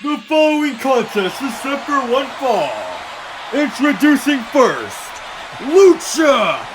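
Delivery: slow, drawn-out announcer call; ring announcer. Acted. Announcer introducing Luchasaurus to the crowd, drawn-out call. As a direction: boom the name to the arena.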